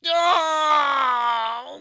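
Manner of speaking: loud sharp cry of pain. A narrator's reading. Possessed man crying out in sudden pain. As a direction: yelp as something invades you.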